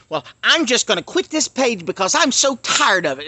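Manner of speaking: high, loud whiny imitation; mock fed-up quitter. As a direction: play the commenter making a dramatic exit. Mimicking commenters who announce they quit.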